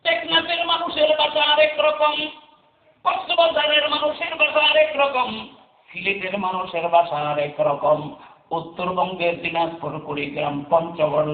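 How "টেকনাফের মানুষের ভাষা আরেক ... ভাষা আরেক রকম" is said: high, sung-out sermon lines; preaching at full force. Acted. Preacher listing Bangladesh's regions to the crowd, in high preaching chant. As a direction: call each place name out high.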